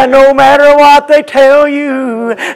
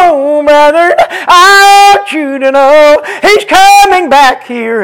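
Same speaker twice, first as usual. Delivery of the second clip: raised, sung-out preacher's whoop on each phrase; whooped preaching; 5 semitones higher, 5 dB louder than usual. Acted. Pastor whooping to his congregation about Christ's return, chanted. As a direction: lift it into a chanted, tuneful whoop.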